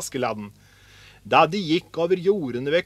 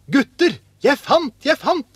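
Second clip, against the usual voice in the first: high, loud cry to the others; excited calling out; 10 semitones higher, 6 dB louder than usual. A narrator's reading. Askeladden hails the boys about his find, excited. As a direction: shout the news of a find.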